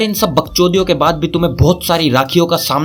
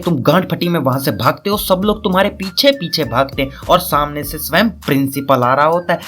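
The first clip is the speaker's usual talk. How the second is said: normal talking voice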